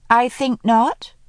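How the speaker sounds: firm refusal. Acted. Helen refusing to sit down, slow and firm.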